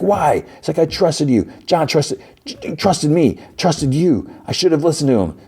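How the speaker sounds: high, hurt accusation; anguished anger. Acted. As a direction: rage at being betrayed by his people. Parker screams after John's death.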